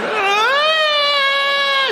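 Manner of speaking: high shrieking mock cry; mock wail. Teacher bursting into tears, in a high shrieking mock wail.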